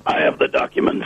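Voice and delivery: breathy near-whisper Alex Jones voice; Alex Jones impression. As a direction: whisper it like a conspiracy radio host. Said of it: "As Alex Jones would say".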